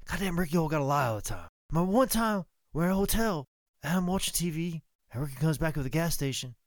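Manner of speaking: high-pitched storyteller impression; Bobby Eaton. Bobby telling a story on his lying partner, griping. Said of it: "I'm gonna try and do it in Bobby's voice".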